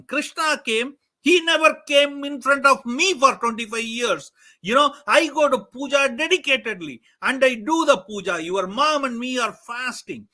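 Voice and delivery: loud, high indignant scolding; furious father scolds his son; angry scolding father. Acted. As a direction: hurl the disbelief at him.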